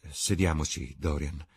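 plain reading voice

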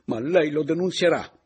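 high, loud, insistent outburst; exclaimed. Acted. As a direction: cry out as a forceful demand. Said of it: "esclamò ella levandosi in piedi". (esclamazione alta e perentoria)

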